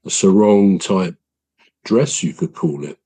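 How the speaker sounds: ordinary talk, nothing marked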